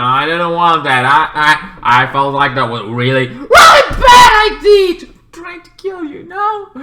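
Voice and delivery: builds to a high shout; girl's rising protest. Retold or imitated. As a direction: climb into a high, loud cry.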